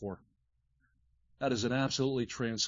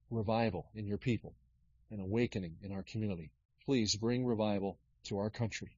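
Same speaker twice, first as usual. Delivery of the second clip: soft, low prayer voice; quiet earnest prayer; 4 semitones lower, 4 dB quieter than usual. Acted. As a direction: pray quietly and sincerely to God. Prays for the meeting.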